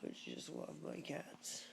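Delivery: ordinary talk, nothing marked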